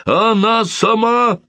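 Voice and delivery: high, slow joyful shout; excited shout. Acted. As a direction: burst out with joy. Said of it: "закричал он".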